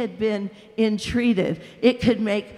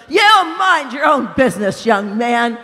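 loud, higher angry snap; angry rebuke; 3 semitones higher, 8 dB louder than usual. A narrator's reading. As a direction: tell him off sharply. Ghost rebuffs the other.

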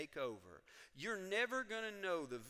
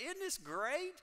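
usual speaking voice

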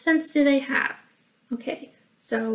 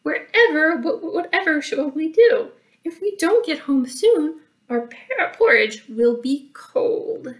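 higher, worried motherly fussing; fretting mama bear; 5 semitones higher, 3 dB louder than usual. Acted. Mama Bear worrying about the porridge, fretting. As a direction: fret aloud, starting high and settling at the end. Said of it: "Mama Bear fretted".

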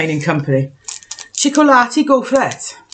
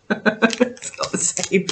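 hushed aside; 6 dB quieter, much slower than usual. Real, not acted. Cake differs from the picture.

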